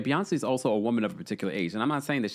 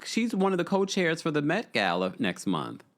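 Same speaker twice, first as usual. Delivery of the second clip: usual speaking voice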